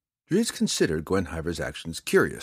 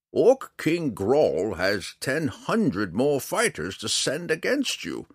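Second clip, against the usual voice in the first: high, very slow character voice; old man, warning; 7 semitones higher, 4 dB louder, slower than usual. Acted. Old man presses the drow about orc fighters, slow and high. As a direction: drag out each word, pitched up.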